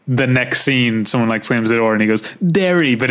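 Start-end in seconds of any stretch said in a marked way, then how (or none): 2.4-2.9 stuffy-nosed, congested delivery; nasal sick voice. Retold or imitated. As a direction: say it like you've got a bad cold, nasal. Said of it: "sick voice".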